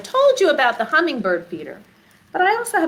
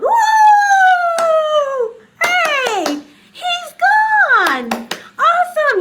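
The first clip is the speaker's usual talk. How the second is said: high, loud shout of joy; high loud excited yell; loud cheer; 5 dB louder, much slower than usual. Shown as a demonstration. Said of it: "Now we're very loud".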